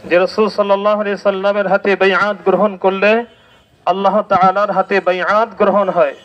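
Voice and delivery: ordinary talk, nothing marked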